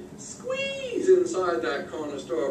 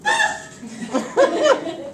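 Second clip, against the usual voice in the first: building up; 10 semitones higher, much slower than usual. Acted. Frog puffing up, rising pitch.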